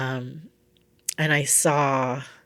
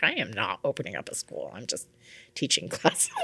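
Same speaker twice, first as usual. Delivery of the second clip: lower, insistent retold protest; mock firm denial; 4 semitones lower, 4 dB quieter than usual. Teacher answering her stepdad about her classes, mock insistent. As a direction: dig in with a low denial, dropping away into laughter. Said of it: "I'm like".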